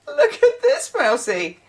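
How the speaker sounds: very high-pitched cutesy exclamation; mock excited squeal. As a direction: squeal it like a proud little show-off. Voices showing off the caught mouse.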